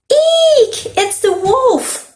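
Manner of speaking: very high storybook shriek; hammed-up high-pitched fright. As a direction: squeal in alarm, stretching the last word.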